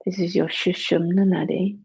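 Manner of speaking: ordinary delivery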